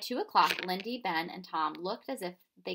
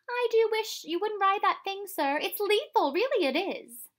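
much higher, louder pleading woman's voice; worried housekeeper; 13 semitones higher, 8 dB louder than usual. A narrator's reading. Missus Primrose begs the professor not to ride, high and anxious.

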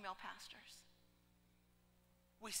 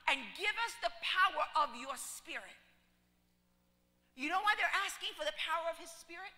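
higher and louder, building passion; fiery preaching; 6 semitones higher, 6 dB louder than usual. Acted. Preacher rousing the congregation, full-force preaching. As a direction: preach it out to the church.

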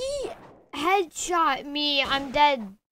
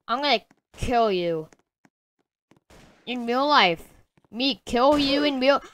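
ordinary talk, nothing marked

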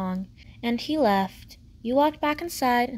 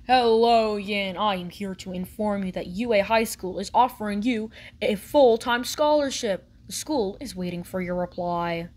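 lightly voiced message reader; 2 semitones lower than usual. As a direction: deliver it like an official announcement.

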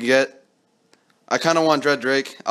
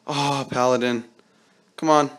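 usual speaking voice

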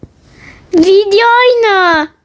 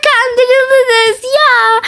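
high teasing laugh; laughing tease; 6 semitones higher than usual. Real, not acted. Vlogger laughing at the followers she pranked. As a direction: laugh while gloating at the fooled fans.

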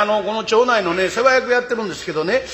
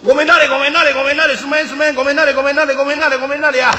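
loud, high chant of pardon me; pushing through the crowd; 5 semitones higher, 4 dB louder, faster than usual. Acted. Elbowing to the front of the crowd, loud sing-song pardon. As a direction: squeeze forward, calling excuse me over and over.

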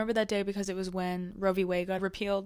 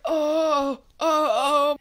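higher, louder pained oh's; pained cry; 6 semitones higher, 8 dB louder, much slower than usual. Retold or imitated. Biker hitting the ambulance door, crying out higher and louder. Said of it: "and he's like".

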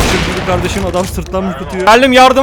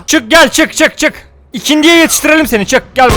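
teasing call-out; 7 semitones higher, 4 dB louder than usual. Real, not acted. Calling enemy out.

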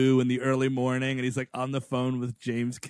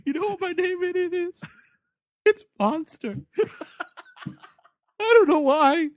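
mock high voice; 6 dB louder than usual. Voicing Arnold's character, laughing.

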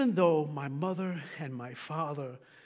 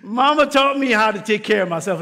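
"Mama taught me" preached louder; emphatic repeat; 3 semitones higher, 11 dB louder than usual. Acted. Preacher repeating to the congregation, louder emphatic. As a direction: drive the line home with louder voice.

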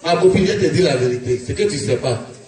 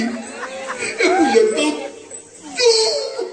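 wailing; 10 semitones higher, much slower than usual. Acted. Dramatic retelling of near death.

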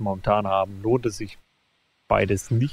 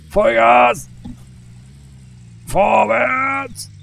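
high, loud battle cry; rallying shout; 11 semitones higher, 7 dB louder, much slower than usual. Real, not acted. Gamer urging his vehicle forward, loud rallying shout. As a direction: yell "Vollgas" as if charging forward.

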